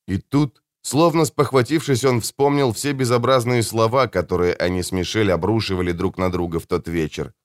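plain reading voice

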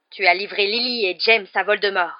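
loud, quivering accusation; trembling accusation. A narrator's reading. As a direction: accuse him with contained rage. (accusation frémissante)

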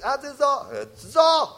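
imita al coach japonés. Retold or imitated. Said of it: "Kunio nos decía".

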